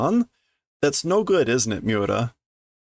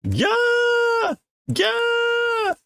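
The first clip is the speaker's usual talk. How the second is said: very high shrieked yeah; piercing childish scream; two screaming girls. Acted. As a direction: scream in terror at the frog. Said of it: "they're both screaming now".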